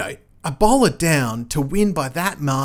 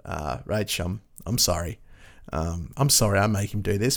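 sheepish apology; 6 semitones lower, 5 dB quieter than usual. Real, not acted. Apology to colleague's wife.

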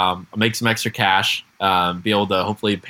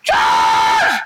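louder mock scream of "Josh!"; 6 semitones lower, 4 dB louder than usual. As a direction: yell "Josh!" like searching the woods. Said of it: "they're screaming".

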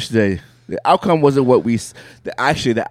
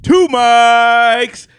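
ringmaster; 11 semitones higher, 4 dB louder, much slower than usual. Acted. Podcast intro, announcer style.

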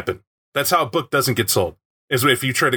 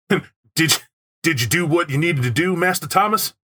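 lightly raised, theatrical chauffeur voice; hammy butler voice; 4 semitones higher than usual. Shown as a demonstration. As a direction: overplay a polite butler. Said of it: "why did I use it in that voice".

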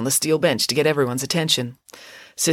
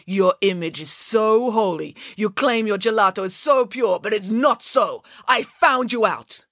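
slow, emphatic denunciation, pitched up; accusing; 6 semitones higher, slower than usual. A narrator's reading. Man exposes the nun's impure gelato, accusing. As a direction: drive each charge home slowly. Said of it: "accused Ezio".

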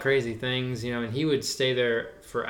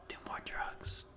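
hushed whisper, lightly mocking; conspiratorial whisper; 5 semitones higher, 16 dB quieter than usual. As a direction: lean in and whisper the bad idea. Whispering in imagined Beatles banter.

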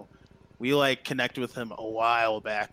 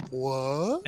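gasping what then laughing; stunned gasp into laugh; gasp of surprise; 5 semitones higher than usual. Retold or imitated.

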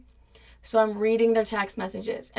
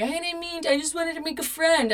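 higher, whiny mock sob; mock crying; 8 semitones higher than usual. Boyfriend crying his excuse, mocked in a higher whine. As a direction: imitate him whimpering an excuse. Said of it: "He's crying".

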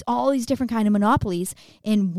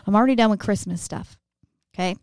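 ordinary talk

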